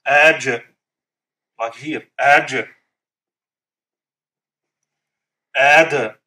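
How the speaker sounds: "Aj" and "Ad" with the final consonant released in a short bounce; qalqala bounce. Shown as a demonstration. Teacher demonstrates qalqala on jim and dal, bouncing. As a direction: bounce the last letter clearly. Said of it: "In order to know how clear is it".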